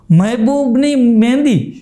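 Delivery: the real title read slowly with a laugh. Retold or imitated. Reading the poster's real title, slow and laughing. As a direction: savour each word of the title.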